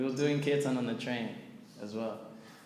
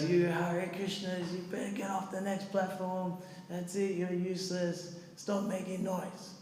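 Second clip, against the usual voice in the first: put-on swearing drunk guy; 5 semitones higher, 3 dB quieter than usual. Drunk passenger berating the chanters, mock swearing. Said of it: "some homeless drunk guy".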